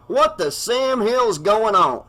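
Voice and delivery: high, slow, indignant bartender; gruff townie. A narrator's reading. Earl demands of the sheriff what is going on, indignant. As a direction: demand answers, slow and outraged.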